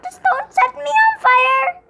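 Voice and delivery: high, loud panicked plea; high frantic yell; panicked shout. Real, not acted. Game character burning him.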